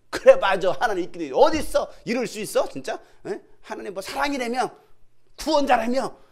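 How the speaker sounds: loud, bitter accusing outburst; resentful complaint. Retold or imitated. Believer with faith run dry, loud resentful protest. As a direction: pour out the grievance at God. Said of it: "원망과 불평이 다 쏟아져 나와요".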